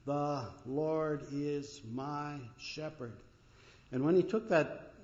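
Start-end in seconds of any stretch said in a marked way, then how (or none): 0.1-3.2 very slow, one word at a time. Retold or imitated. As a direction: count it out slowly, separating each word. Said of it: "Use each word and count on your fingers".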